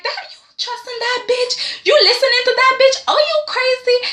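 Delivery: high-pitched disbelieving shriek; incredulous squeal. Retold or imitated. Family shrieking at Eric for trusting Quinn, shrill incredulity. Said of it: "everybody's like".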